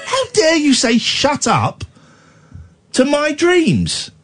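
high, slow, wailing mock hurt; host wailing at his co-host in mock hurt; mock wounded outrage. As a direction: ham up being deeply offended.

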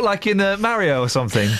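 usual speaking voice

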